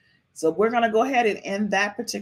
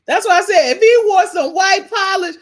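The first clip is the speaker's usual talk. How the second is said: high and loud, nearly yelling; telling viewers, shouting and much higher; excited shout; 11 semitones higher, 8 dB louder than usual. Real, not acted. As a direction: belt it out to the chat. Shouting emphasis to chat.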